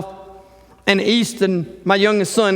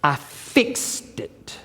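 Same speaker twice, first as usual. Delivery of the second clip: slow, breathy whisper; whispered satisfaction; 5 semitones lower, 7 dB quieter, much slower than usual. Real, not acted. Pastor closing mouse story, slow whispered pride. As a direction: let it out softly, pleased.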